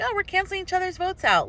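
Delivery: high, lightly mocking voice; joking voter friend. Joking to a Republican friend in line, high mocking voice. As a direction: toss it off as a breezy joke. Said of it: "We're like".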